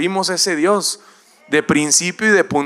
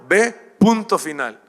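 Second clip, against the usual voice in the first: firm command from God; 4 semitones higher, 5 dB louder than usual. Acted. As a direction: lay down the order with no room to argue. God answers excuses firmly.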